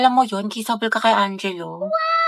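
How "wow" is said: very high, sudden wow; surprised wow. Acted. Reacting to lip tint mention. (gulat na wow)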